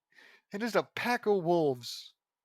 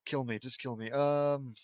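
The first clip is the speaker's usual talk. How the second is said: ordinary talk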